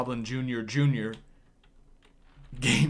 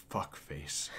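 whispered joke; 8 semitones lower, 9 dB quieter than usual. Acted.

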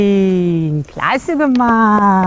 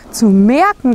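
ordinary delivery

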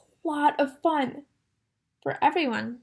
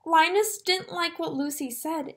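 usual speaking voice

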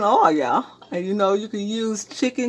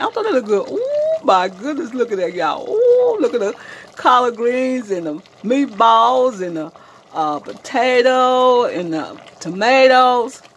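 delighted admiration; 4 semitones higher, 6 dB louder than usual. Real, not acted. Home cook showing viewers the pot of greens and meatballs, delighted admiration. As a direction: marvel loudly, lingering on each ingredient.

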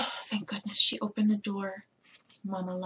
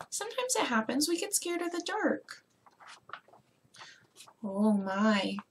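plain reading voice

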